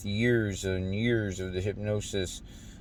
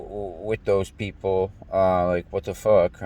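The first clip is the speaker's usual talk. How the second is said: normal talking voice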